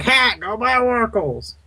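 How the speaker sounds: high-pitched mock yell; yelling teammate impression. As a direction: mimic a teammate's squawked yell. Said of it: "That was my impression of you yelling at me".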